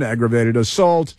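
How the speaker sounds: ordinary talk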